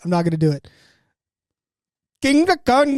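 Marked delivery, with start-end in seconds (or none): 2.2-3.0 high, punchy Indian English on the name; Indian accent impression. Friend baits him into mock Indian accent, said high. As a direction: caves in and says it in the vlogger's Indian accent. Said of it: "Okay, I did it".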